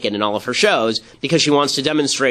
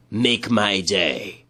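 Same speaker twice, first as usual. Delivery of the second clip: low, very slow, softer tough-guy line; Clint Eastwood growl; 6 semitones lower, 3 dB quieter, much slower than usual. Retold or imitated. Imagined Clint Eastwood at the convention, low and drawn out. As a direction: drag it out like a movie cowboy. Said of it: "if Clint Eastwood ... had said".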